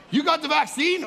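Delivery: high, loud mock outraged critic; mock outrage. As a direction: sneer it like a busybody. Mocking critic.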